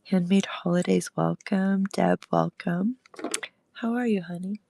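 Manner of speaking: ordinary talk, nothing marked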